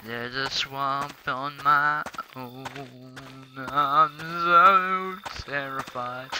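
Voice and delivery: very slow, dragged-out mock dread; slow-motion voice. Gamer narrating his lonely swamp fear in slow motion. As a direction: stretch every word like slow motion. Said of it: "slow motion and deep, dark, uh, voice".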